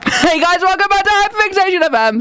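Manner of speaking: high-pitched, overwrought radio greeting; mock-hysterical welcome; passionate show intro. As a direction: greet listeners as if still mid-meltdown. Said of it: "I got a bit passionate there".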